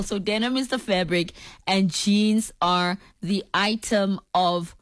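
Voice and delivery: usual speaking voice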